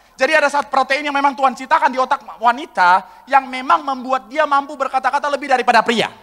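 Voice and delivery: usual speaking voice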